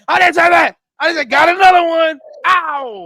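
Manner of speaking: high, loud mock protest; hammed-up shouted objection; outraged killer shouting. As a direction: yell over the press conference. Killer heckling at press conference.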